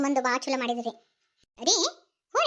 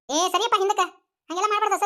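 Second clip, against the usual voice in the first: put-on higher, louder telling-off of a child; scolding shout; 5 semitones higher, 3 dB louder than usual. Woman scolding the boy who pulls the girl's hand, loud rebuke. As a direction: snap at the boy to step back.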